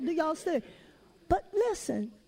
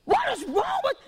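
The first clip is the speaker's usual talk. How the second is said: high mock yell at the TV; imitating husband yelling; 10 semitones higher than usual. Wife imitating husband yelling at the game, high mock shout. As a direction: belt it like a fan mad at the game. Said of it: "my husband started yelling".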